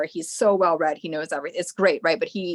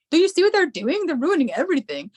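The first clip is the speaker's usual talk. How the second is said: high, louder outraged complaint; indignant protest; 9 semitones higher, 5 dB louder than usual. Retold or imitated. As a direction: rant like a tree watching the axes. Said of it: "And I was like".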